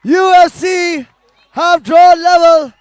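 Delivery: high, loud and slowed, savouring the moment; drawn-out excited call over the noise of the crowd; thrilled commentary. Real, not acted. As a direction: ride the wave of the equaliser. Aftermath of the equaliser.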